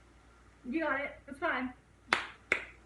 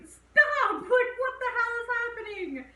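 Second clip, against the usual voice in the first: high, much louder panicked yell of a scared daughter; frantic yell; 10 semitones higher, 6 dB louder than usual. Retold or imitated. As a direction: scream at her to stop, half acted. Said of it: "so then the daughter's like".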